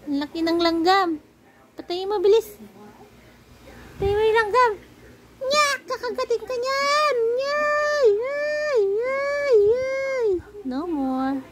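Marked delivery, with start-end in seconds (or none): 0.1-4.7 rising, urgent high call; alarmed. Real, not acted. Spots big ant, urges killing it. 5.4-10.3 very high drawn-out wailing squeals; playful alarmed squealing. Pretend. Squeals that the ant will bite.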